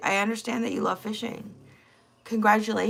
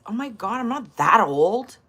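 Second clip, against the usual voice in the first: ordinary delivery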